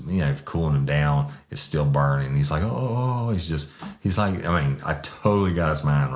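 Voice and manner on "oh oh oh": high mock moan of pained relief; imitated moan. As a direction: mimic someone moaning under cool water. Imitating man moaning under hose.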